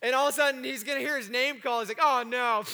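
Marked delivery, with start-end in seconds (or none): 2.0-2.6 loud, comic cry of dismay; mock dismay. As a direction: play up the reluctance of being yanked away. Acting out Lazarus pulled from heaven.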